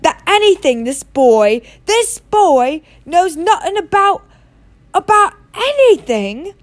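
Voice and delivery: high, loud, mounting disbelief; angry growl. A narrator's reading. Hagrid accusing the Dursleys over Harry's ignorance, growling angrily. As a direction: pile up the outrage, dragging out the last words. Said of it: "he growled as the Dursleys".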